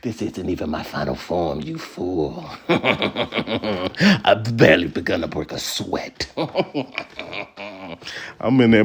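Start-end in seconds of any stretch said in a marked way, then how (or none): 0.0-2.4 lower, softer menacing villain voice; sinister villain. Retold or imitated. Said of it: "I'm feeling like a villain". 2.7-6.3 slow, drawn-out villain taunt; smug villain. Retold or imitated. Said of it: "I'm feeling like a villain".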